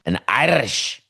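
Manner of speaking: Irish accent with a rolled R. Shown as a demonstration. Host demonstrating his two-word repertoire, Irish accent. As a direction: have a go at Irish, rolling the R. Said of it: "get to roll my R's".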